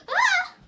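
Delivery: high, loud frightened scream; startled scream. Acted. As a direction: shriek in sudden fright. Skit, scared by raid.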